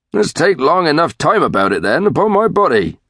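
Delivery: country father put out with his son; exasperated tranter. Acted. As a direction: chide him in mild exasperation. Tranter scolds his late son.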